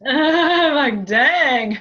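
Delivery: laughing, loud and high; laughing exclaim. Real, not acted. Medium reacting to a tall father, laughing loudly. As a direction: burst out laughing at how tall he is.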